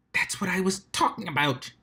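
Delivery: hushed whisper; whispered thought. Acted. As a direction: breathe it under your breath. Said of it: "she sent telepathically".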